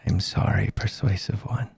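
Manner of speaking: low, soft creepy monster. Acted. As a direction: purr it with quiet menace. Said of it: "I like your creepy voice".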